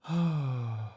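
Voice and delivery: long weary sigh; heavy sigh. Acted. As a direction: let out a big reluctant sigh. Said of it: "Bertie sighed heavily".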